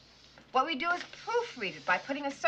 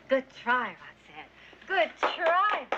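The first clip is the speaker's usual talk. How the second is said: ordinary talk, nothing marked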